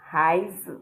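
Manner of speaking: "hai z" with z cut short; neutral tone. Shown as a demonstration. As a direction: stop suddenly on the z of "hai z". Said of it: "It sounded like I stopped talking abruptly".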